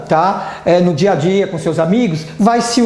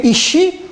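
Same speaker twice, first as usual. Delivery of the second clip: "Is she?" repeated much higher with a rising tune; high question tune; 10 semitones higher than usual. Shown as a demonstration. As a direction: ask "Is she?" again, lifting it high. ("Is she?" repetido bem agudo, perguntando)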